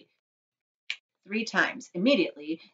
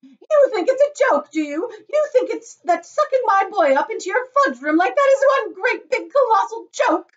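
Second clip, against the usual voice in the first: high, loud furious tirade; outraged shriek; 12 dB louder than usual. A narrator's reading. Mother confronts giggling chocolate maker, shrieking. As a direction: rant at full pitch. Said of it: "she shrieked".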